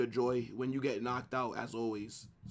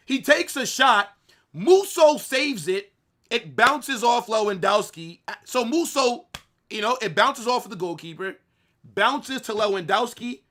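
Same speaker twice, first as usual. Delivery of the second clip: excited play-by-play; 7 semitones higher, 7 dB louder than usual. Real, not acted. Replaying goal play-by-play.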